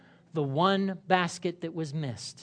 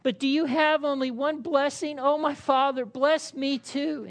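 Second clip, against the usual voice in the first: higher, slowing plea; pleading; 7 semitones higher than usual. Acted. Esau begging his father for one blessing, pleading. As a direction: beg your father, drawing out bless me too. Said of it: "Esau pleaded".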